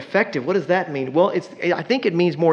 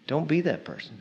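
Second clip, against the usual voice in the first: much softer repeat of don't be that person; quiet repeat; 8 dB quieter than usual. Shown as a demonstration. Preacher repeating warning to congregation, much softer. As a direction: drop the voice for the echo.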